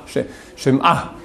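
Shema drawn out slowly, She- first. Shown as a demonstration. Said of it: "Hey, Shema". (Shema lent, décomposé)

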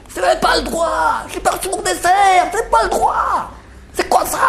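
very high, shrill mock yell of an old woman; hammed-up old lady hollering down the hall; mock old lady shouting. Speaker imitates the old lady yelling from her room, very high. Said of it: "Elle hurle, elle hurle".

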